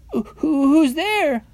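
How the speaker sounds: very high, stammered piggy call; little pig calling. A narrator's reading. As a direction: call through the door as a nervous piglet. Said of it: "called the second little pig".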